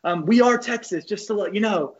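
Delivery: high put-on coach voice; Coach Garrido. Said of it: "He's like".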